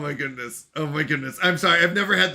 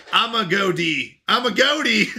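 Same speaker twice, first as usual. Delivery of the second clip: giggling through a silly mispronunciation; laughing mock pronunciation; 4 semitones higher than usual. Streamer joking to chat, giggling mispronunciation. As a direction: say it laughing at yourself. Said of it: "I'd say it like".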